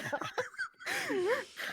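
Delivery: high, thin giggle; real high-pitched cracking-up laugh; helpless laugh. Real, not acted. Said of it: "I can't do it without laughing".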